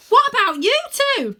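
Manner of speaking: very high, loud call across; worried shout. Acted. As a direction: shout the question over the gap. Win calls across.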